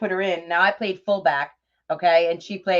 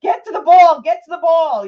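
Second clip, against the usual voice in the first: higher, louder sideline yell; father's yelling; 9 semitones higher, 10 dB louder than usual. Retold or imitated. Mom imitating her father yelling at her sister's soccer game, loud yell. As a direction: bellow it like a dad on the sideline. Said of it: "your father's yelling".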